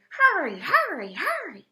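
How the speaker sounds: high, loud, urgent pelican voice; urgent pelican hurry. A narrator's reading. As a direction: rush the boy, high and pressing. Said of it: "whispered the pelican".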